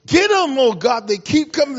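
David fired up, pleading God to get them; worked-up David. Retold or imitated. Preacher voicing an agitated David to the congregation. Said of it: "David would get all up in his feelings".

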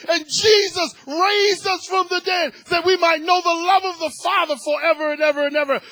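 impassioned preaching. Real, not acted. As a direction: cry it out to God at full force. Preaching peak, crying and shouting.

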